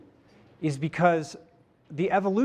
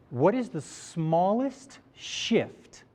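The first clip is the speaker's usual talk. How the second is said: slow, spaced words to write down; slow dictation; 2 semitones higher, much slower than usual. Shown as a demonstration. Speaker dictates the question to the audience, slow and spaced. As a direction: say it slowly so they can note it. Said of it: "I'll repeat it a couple times".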